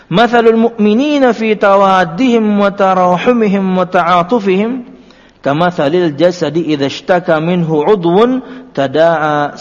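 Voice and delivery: slow, loud, measured Arabic recitation; hadith recitation. Acted. Preacher reciting the Prophet's hadith to the congregation, solemn and slow. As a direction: recite the hadith with weight and care.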